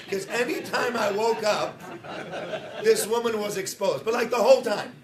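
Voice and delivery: can't hold back the laughter while telling it; laughing. Real, not acted. Laughing while telling flight story.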